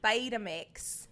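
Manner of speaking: slow, drawn-out Australian accent attempt. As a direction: stretch the vowels into mock Australian. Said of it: "an Australian accent when they s".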